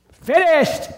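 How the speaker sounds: sudden high, very loud proclamation; hammed-up one-word shout; great cry. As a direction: cry it out in triumphant full voice. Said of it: "And then the great".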